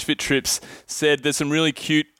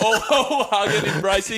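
laughing; 6 semitones higher than usual. Real, not acted. Laughing describing photo.

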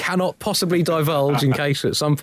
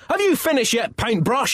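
higher mock shout; 11 semitones higher than usual. The angry Pope, mock-shouting at the artist through the door. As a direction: call out impatiently through the door. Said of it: "he shouted".

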